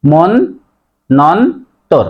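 মন্বন্তর in three slow pieces, mon, non, tor; correct pronunciation. Shown as a demonstration. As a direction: pause between syllables of মন্বন্তর, doubling the n. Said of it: "সে কারণে উচ্চারণ হবে".

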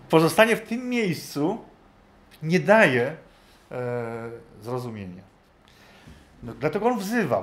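ordinary talk

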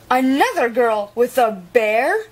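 ordinary delivery, nothing marked

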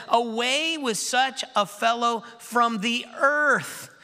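high, slow crowd cry; a mob calling out slowly. A narrator's reading. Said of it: "they raised their voices and said".

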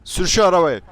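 loud yell, pitch up; yelling to drive. Acted. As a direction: bark the order to drive at him.

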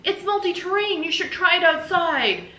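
high, loud put-on lady voice; shrill exclaiming wife. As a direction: squeal it like a flustered neighbour. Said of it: "She goes".

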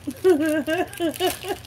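high short burst of laughter; chuckling at a friend's note; amused laugh. Real, not acted. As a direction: laugh at the little joke.

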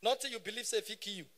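normal talking voice

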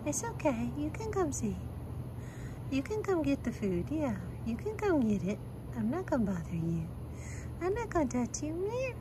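high, soft coaxing coo to shy cats. Real, not acted. Woman coaxing scared gas station cats, soft high coo. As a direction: sweet-talk the stray cats softly.

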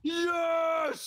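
excited shout. Real, not acted. Cheering over the burger.

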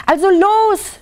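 high-pitched impatient storybook cry; vulture's yell. A narrator's reading. Lämmergeier to silent red-headed Lieschen, high impatient yell. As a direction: urge her on with a high cry. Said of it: "schrie der Lämmergeier".

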